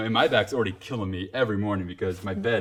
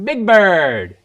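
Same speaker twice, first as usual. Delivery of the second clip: higher, even louder Big Bird; 10 semitones higher, 12 dB louder than usual. Pretend. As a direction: belt the bird name big and bright. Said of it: "let me do one more time".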